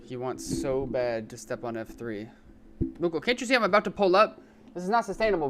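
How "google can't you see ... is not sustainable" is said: higher, louder frustrated complaint; exasperated outburst. Real, not acted. Gamer scolding his cat for blocking the keys, exasperated. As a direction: vent at the cat, fed up.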